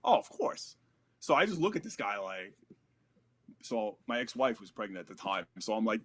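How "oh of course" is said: plain reading voice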